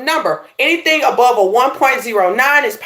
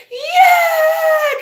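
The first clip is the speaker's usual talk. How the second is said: high, drawn-out cheer; long high-pitched rallying whoop; hyped crowd yell. Retold or imitated. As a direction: imitate an eager conference crowd hollering back. Retold conference crowd answering eagerly.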